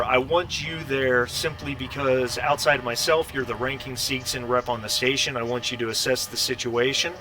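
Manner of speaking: ordinary talk, nothing marked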